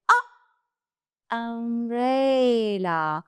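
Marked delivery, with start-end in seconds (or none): 0.1-1.3 A as a short uh sound. Shown as a demonstration. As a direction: say A as a clipped uh. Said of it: "the sound of U is".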